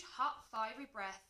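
usual speaking voice

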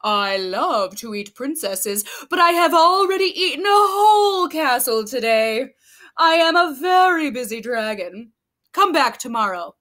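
big booming dragon, bored and busy. Acted. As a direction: play the grumpy dragon brushing her off. Dragon voice in read story.

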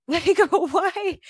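laughing disbelief. Real, not acted. Disbelieving laugh after awe.

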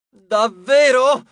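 high, loud, drawn-out cry to the heavens; outraged shout. A narrator's reading. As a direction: yell it skyward in outrage. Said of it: "urlò alla volta Celeste". (urlo indignato)

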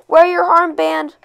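high, slow, drawn-out call; shouting. A narrator's reading. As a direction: call it out like an uncle after running children. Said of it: "Uncle Shepsel shouting".

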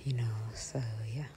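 soft whispered aside; hushed, intimate narration. Real, not acted. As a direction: whisper to the camera.

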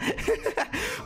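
laugh. Real, not acted. Laughs at song.

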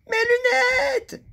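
high, slow, loud wail; cry. Acted. Monsieur Malin wailing over his broken glasses, loud and high. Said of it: "cria Monsieur Malin".

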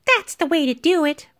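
put-on high little-girl voice; triumphant girl. A narrator's reading. Trina shows Will how it's done, high-voiced. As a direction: pitch it up like a girl showing off.

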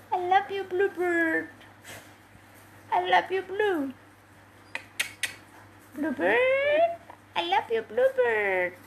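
high, drawn-out sweet talk; cooing to pet. Real, not acted. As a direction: coo it high, stretching the name. Cooing affection to pet bird. (suara manja untuk burung)

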